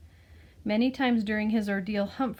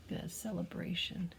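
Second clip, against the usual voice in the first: plain reading voice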